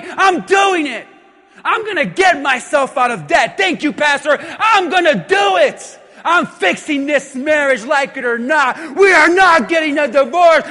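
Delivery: building to a high, loud shout; acted convert pumping themselves up; fired-up resolve. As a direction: shout each vow with mounting determination. Acts out congregant's rising fervor.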